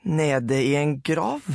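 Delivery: slow, squeaky and thin; voice cracking. A narrator's reading. Boy asks Sara in disbelief, voice cracking and squeaky. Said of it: "Stemmen knakk, ble pipet og tynn".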